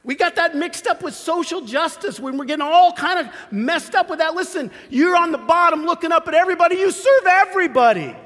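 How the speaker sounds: high, loud, pressing preaching; fired-up preaching. Acted. Pastor firing up the congregation, high and loud. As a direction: drive it home to the church.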